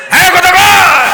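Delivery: high, loud shout to the crowd; loud call-out. Real, not acted. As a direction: shout it out to the listeners, pitched high. Preacher calls out to his audience. (উঁচু গলায় হাঁক)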